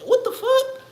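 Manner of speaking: a sudden disbelieving yelp; high-pitched stunned outburst; shocked squeak. Retold or imitated. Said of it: "I seen John Wick and was like".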